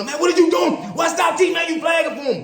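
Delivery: high yelling rant at the ref; mock furious coach yelling; retelling the coach yelling at the referee, high and loud; coach going off. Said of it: "he going off on the ref".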